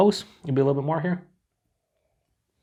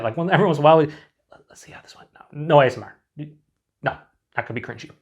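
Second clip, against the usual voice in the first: ordinary talk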